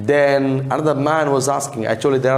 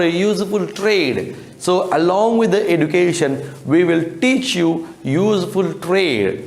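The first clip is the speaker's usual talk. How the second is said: higher, lightly voiced gentleman addressing the boy; a hint of a pompous official; 6 semitones higher than usual. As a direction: gentleman telling Oliver about his trade.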